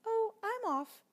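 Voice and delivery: very high, dainty mouse voice; tiny mouse asking for tea; polite little mouse. Acted. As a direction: squeak it out politely. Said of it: "said the mouse".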